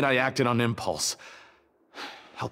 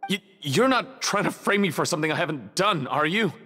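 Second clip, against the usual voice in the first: nervous stammer; 6 semitones higher than usual. Acted. Suspect fears being framed.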